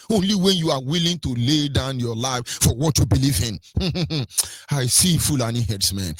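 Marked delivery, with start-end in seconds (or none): 3.6-4.7 soft closed chuckle; real chuckle. Real, not acted. Speaker before naming the herdsmen, quiet chuckle.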